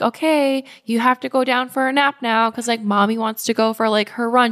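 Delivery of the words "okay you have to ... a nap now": higher, sing-song bedtime voice to her son; mother settling her toddler for a nap, a touch higher and sweeter; lightly voiced mom to toddler. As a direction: coax the little one toward his nap. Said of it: "I prep him, like".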